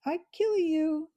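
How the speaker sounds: high, loud angry bird threat; angry sparrow chatter. Acted. Captive sparrow threatens Matthias from under the basket, angry chatter. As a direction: spit it out like a furious sparrow. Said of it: "she chattered angrily".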